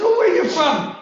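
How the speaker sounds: high cheerful call out; friendly call. Retold or imitated. Preacher asking the stranger where he's from, high friendly call. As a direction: sing out a friendly question.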